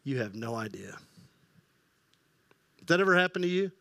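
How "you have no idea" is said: inner thought. Retold or imitated. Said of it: "you're thinking the whole time".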